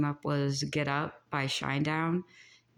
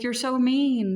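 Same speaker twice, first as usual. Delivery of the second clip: mock whine, higher and louder; whiny accuser; 8 semitones higher, 5 dB louder, slower than usual. Psychic mocking a bully who calls her mean, mock whine. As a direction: sulk it out like a wounded bully. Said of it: "they'd be like".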